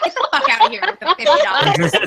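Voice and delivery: mock outrage with laughter; playfully furious, giggling; laughing. Mock angry refusal, laughing.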